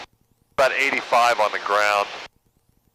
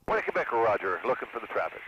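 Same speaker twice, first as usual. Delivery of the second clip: pilot radio call, quieter; over the radio; 3 semitones lower, 10 dB quieter than usual. Real, not acted. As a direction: speak into the aircraft radio.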